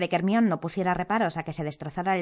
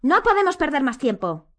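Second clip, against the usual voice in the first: loud, high urgency; urgent insistence; 8 semitones higher, 5 dB louder than usual. A narrator's reading. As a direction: push them to act now. Harry urgent, just after shouting.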